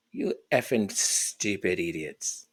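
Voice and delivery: low, soft, slow contemptuous growl; muttering scorn at the pundits; contemptuous insult. Real, not acted. Ranting at the commentators, low and scornful.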